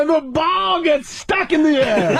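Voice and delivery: high, stretched mock highlight call; sportscaster play-by-play. Comic calling a freeze-framed basketball shot, excited sportscaster. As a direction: announce an impossible play with amazement. Re-enacting his sportscaster bit.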